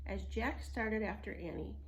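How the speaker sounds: plain reading voice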